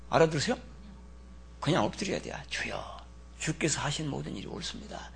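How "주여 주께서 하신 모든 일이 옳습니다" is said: soft, humble prayer; hushed prayer. Pretend. Humble believer praying face down, hushed. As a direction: pray it quietly, bowed down. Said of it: "하고 엎드리는 거예요".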